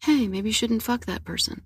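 hushed, tentative little warning; really quiet inner voice. Acted. Guest voices the inner part of ourselves, really quiet. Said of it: "really quiet".

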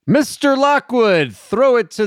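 excited high callout. Real, not acted. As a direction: call out the name brightly. Calling for the theme song.

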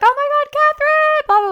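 very high, loud mock squeal; imitated fan scream. Girls driving past recognize her, imitated excited scream. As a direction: shriek it like a passing fan. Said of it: "screamed my name".

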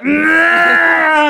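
loud, high blaring bus noise; mock engine roar; imitated bus roar. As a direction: blast it out like a passing bus. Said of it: "a bus would be like".

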